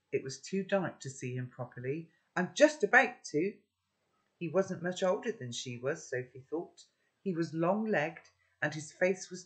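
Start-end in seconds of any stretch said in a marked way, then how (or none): 2.4-3.5 higher, louder threat; girl threatening a boy intruder, defiant. A narrator's reading. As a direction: warn him you're about to scream.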